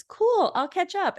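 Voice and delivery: high, perky imitated voice; Bonnie. Newcomer eager to join the strip game, high imitated chirp. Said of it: "And Bonnie says".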